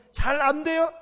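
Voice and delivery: loud, high shouted question; challenging shout. Acted. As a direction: fire the question at the congregation. Acted question-and-answer with congregation.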